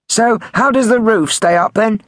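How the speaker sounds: high, loud prank-call voice; prank caller. A narrator's reading. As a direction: deliver the punchline high and loud. Prank caller delivers punchline.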